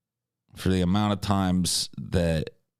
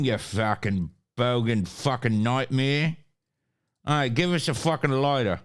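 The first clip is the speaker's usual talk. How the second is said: put-on Australian accent; 3 semitones higher than usual. Streamer playfully insulting a bogan viewer, mock Australian accent. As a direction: greet like a rowdy Australian bogan.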